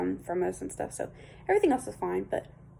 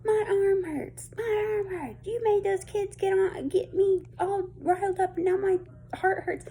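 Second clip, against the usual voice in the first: high-pitched imitated scream about her arm; mock screaming; 12 semitones higher than usual. Imitating the neighbor woman screaming in the road, high mock scream. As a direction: wail it up high, hamming up her complaints. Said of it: "starts screaming".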